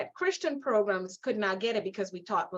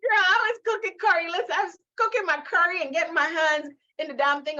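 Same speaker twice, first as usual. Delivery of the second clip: high, loud, tickled outburst; amused exclamation; 7 semitones higher, 6 dB louder than usual. Real, not acted. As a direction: exclaim it fresh from a laugh. Bursts out after laughing about curry nails.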